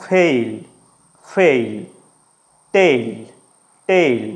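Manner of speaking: "ফেইল, টেইল" with এ gliding into ই; ei diphthong demo. Shown as a demonstration. Teacher drills fail and tail, gliding ei vowel. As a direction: model each word alone, falling, glide heard in ফেইল and টেইল. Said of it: "সেটা উচ্চারণ কীরকম?".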